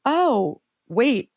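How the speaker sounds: quick, high startled question; imitating surprised clerk. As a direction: play the store guy caught off guard. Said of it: "And he said".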